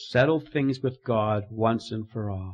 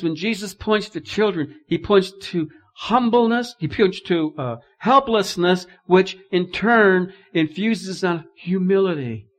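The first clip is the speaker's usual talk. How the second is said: loud, high, full-force preaching; 8 semitones higher, 11 dB louder than usual. Acted. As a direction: preach it out to the congregation.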